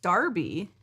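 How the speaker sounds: "Derby" said with an ar, DAR-bee; read as Darby. Shown as a demonstration. Host reads viewers' note on how to say "Derby", pronounced DAR-bee. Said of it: "I would have definitely pronounced it".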